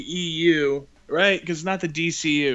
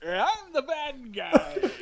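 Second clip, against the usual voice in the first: high, drawn-out cartoonish villain line; mock villain; 10 semitones higher, much slower than usual. Panelist mocking Ares's garbage part, hammed-up slow villain voice. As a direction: stretch it out slowly in a high mocking voice.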